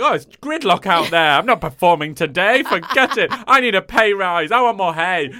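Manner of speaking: loud, high, diva farm animal; grumpy farm animal. Pretend. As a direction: complain like a spoilt goat. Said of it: "they'd all be like".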